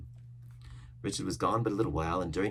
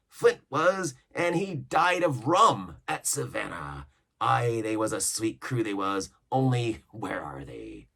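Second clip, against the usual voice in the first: higher, louder pirate voice; pirate cook lecturing; 4 semitones higher, 5 dB louder, slower than usual. Acted. Cook warning shipmates, loud pirate voice. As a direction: drive home the lesson.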